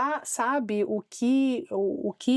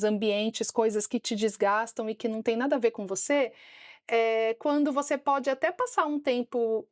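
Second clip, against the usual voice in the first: usual speaking voice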